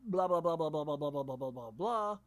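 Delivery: mock babble in one unbroken run, pitch sinking; long rambling spiel. Teacher mimicking a student's long-winded spiel, droning on. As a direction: rattle the blahs off without a break. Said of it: "go into this long spiel".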